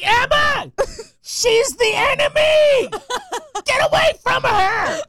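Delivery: hammed-up panic, slow and shrill; dad yelling across the field at his daughter; frantic parent yell. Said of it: "I'm like".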